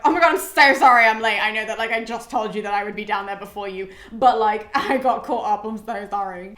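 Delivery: loud, rushed sorry-I'm-late babble; frantic apology. Retold or imitated. Paris rushing up to Hector, loud flustered apology. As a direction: burst in apologising like a latecomer. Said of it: "he's like".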